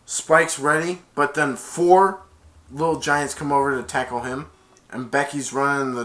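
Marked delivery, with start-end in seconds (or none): none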